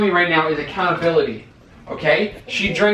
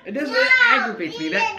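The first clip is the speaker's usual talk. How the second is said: laughing complaint. Real, not acted. Husband laughs while complaining.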